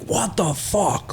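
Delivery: retold angry bark through the window; big bear voice. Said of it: "go into that, like, angry voice, like, big bear voice".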